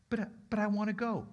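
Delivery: put-on high, stammered childlike objection; plaintive protest. Healed man told to leave the boat, plaintive protest. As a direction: whine a little at being refused.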